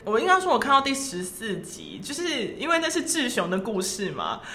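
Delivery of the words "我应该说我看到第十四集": normal talking voice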